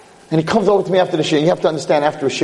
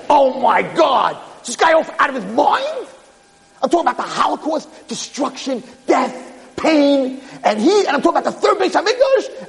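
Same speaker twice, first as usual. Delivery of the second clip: high-pitched, incredulous inner rant; exasperated outburst; 11 semitones higher than usual. Retold or imitated. Rabbi inwardly fuming at the Wi-Fi question, high incredulous outburst. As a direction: rail in disbelief, voice shooting way up.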